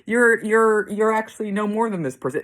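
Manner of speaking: mock revelation.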